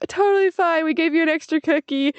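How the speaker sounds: breezy employee. Retold or imitated. Clerk reassures embarrassed customer, high and cheery. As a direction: play the chipper cookie clerk. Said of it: "She's like".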